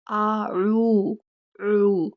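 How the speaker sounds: आ, ऊ stretched long without the throat sound; long open आ ऊ with no आइन; wrong drawn-out vowel. Shown as a demonstration. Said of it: "ऐसे नहीं पढ़ देना".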